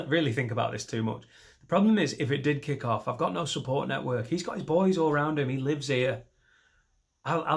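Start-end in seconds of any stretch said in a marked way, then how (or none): 1.6-2.2 loud, high restart; emphatic. Shown as a demonstration. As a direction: hit it harder on problem.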